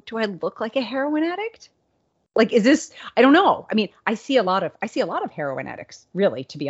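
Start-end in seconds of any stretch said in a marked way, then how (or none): 0.1-3.7 higher, incredulous question; mock-offended plea; indignant disbelief. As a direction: protest the absurd accusation. Asking friend in disbelief.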